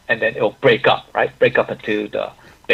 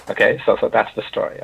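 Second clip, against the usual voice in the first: ordinary talk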